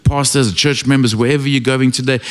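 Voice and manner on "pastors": usual speaking voice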